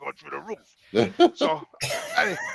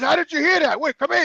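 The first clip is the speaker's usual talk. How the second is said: raised, louder retold demand; urgent questioning; 8 semitones higher, 4 dB louder than usual. Patient asking janitor how he heard, urgent and high. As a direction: re-enact calling someone back in disbelief.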